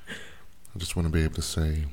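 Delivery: normal talking voice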